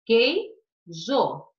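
QUEIJO split into quei, jo; two separate firm beats on Queijo; syllable by syllable. Shown as a demonstration. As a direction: count out both syllables of Queijo with a gap. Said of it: "duas sílabas".

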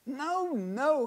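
high wife impatiently correcting. A narrator's reading. Wife correcting her half-asleep husband, impatient. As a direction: set him straight, a little exasperated. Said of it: "his wife corrects him".

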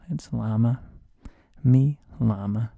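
loud "Mi llama" with a sounded L; hard L pronunciation. Shown as a demonstration. Teacher corrects everybody, loud "llama" with a hard L. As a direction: insist loudly on "llama" with an English L. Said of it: "everybody was saying it wrong except me".

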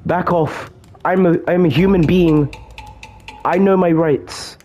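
high-pitched mock-indignant panic; playful panicked protest. Player warding off Ronald in the game, playful panicked protest.

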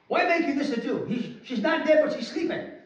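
high, forceful challenge to the mourners; imagined scolding of the mourners; stern rebuke. Retold or imitated. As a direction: rebuke them, pitched well up. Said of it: "I can imagine two ways".

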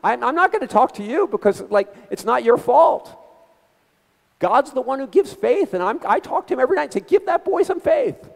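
high-pitched doting aunt impression; high mock old-relative voice, emphatic; Aunt Rose. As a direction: imitate an insistent aunt, voice way up. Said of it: "She said".

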